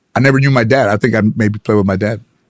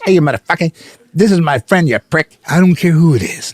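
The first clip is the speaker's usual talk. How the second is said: higher, hammed-up Pesci tirade; mock angry yell; 8 semitones higher than usual. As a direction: tear into him like Joe Pesci. Pesci yelling at pro in story.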